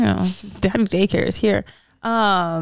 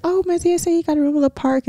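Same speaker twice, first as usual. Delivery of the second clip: lightly put-on voice; 7 semitones higher, faster than usual. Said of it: "I was like".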